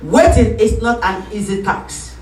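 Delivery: ordinary talk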